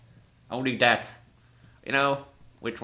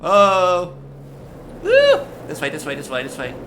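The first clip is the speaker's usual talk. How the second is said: frantic yelp, then urgent directions; being chased; 6 semitones higher, 11 dB louder, slower than usual. Real, not acted. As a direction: shriek and run for it. Fleeing monster in horror game.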